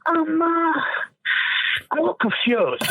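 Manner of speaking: mock puzzled Italian father; dad's puzzled line. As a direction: play dad hiding anger behind confusion. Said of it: "He goes".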